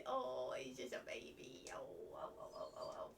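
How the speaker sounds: soft whisper of tenderness; whispered cooing. Real, not acted. Owner cuddling her dog, whispering fondly. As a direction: breathe it out to the dog.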